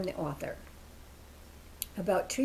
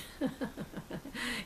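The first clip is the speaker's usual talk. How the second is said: soft chuckle at herself; amused laugh; 4 semitones higher, 7 dB quieter than usual. Real, not acted. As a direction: chuckle softly at your own habit. Laughs at own pronunciation.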